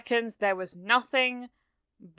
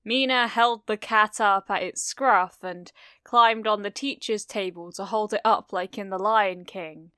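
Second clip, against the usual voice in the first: ordinary delivery, nothing marked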